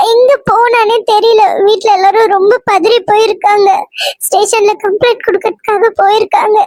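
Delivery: a frightened friend calls Sandy, sobbing down the phone; tearful phone call. Acted. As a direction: choke out the news through tears. Friend calls, sister is missing.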